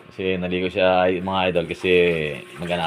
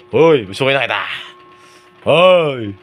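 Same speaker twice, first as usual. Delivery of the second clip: loud, higher teasing "hoy"; playful shout; 5 semitones higher, 5 dB louder, faster than usual. Acted. Husband calling to wife through the door, playful loud shout. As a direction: bark it out to get her attention in fun.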